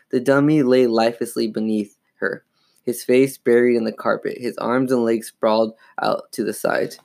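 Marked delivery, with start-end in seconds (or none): none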